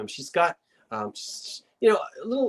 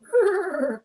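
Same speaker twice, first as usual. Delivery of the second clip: high fluttering whinny; comic horse neigh; mock horse whinny. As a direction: imitate a startled horse neighing, high and trembling. Whinny gag after saying Frau Bucher.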